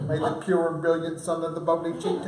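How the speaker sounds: a light evangelical preacher voice, fast. Pretend. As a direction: rattle it off like a preacher. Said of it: "Maybe my evangelical".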